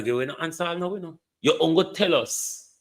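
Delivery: normal talking voice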